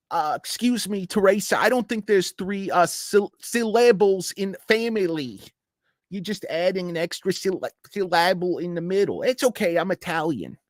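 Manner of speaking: high squeaky reality-show wife; sassy high-pitched Real Housewife comeback; mock housewife voice.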